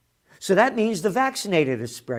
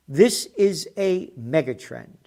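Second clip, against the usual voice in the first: usual speaking voice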